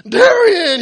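high, drawn-out shouted name; yelling. Acted. Fan greeting his idol in a booth, yelling. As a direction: shout it in wild excitement. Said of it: "he yells".

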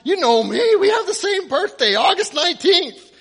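high, loud fan shouting; yelling. Acted. Fan insisting the quarterback knows him, yelling. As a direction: yell it insistently. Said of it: "he yells".

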